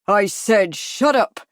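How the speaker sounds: slow, loud savage order; loud and slow, with savage menace. A narrator's reading. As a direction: order silence savagely, weighting each word. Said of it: "Trunky said savagely".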